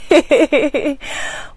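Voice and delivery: short high giggle; tickled little laugh. Real, not acted. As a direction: giggle in a quick ripple. Laughs at own playful word.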